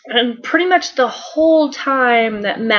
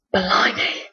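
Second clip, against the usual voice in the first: 1 whispered blimey; 4 dB quieter than usual. Retold or imitated. Doctor checking himself.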